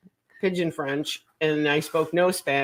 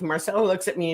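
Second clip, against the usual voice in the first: ordinary talk, nothing marked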